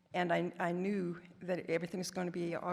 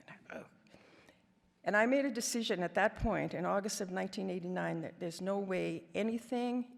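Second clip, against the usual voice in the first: ordinary talk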